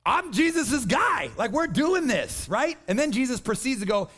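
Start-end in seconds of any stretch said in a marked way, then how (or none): 0.0-2.5 higher, slow, puffed-up Peter; proud disciple bragging, drawn out; cocky Peter. Retold or imitated. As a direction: strut it like the boss's right-hand man. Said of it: "if I'm Peter, I'm like".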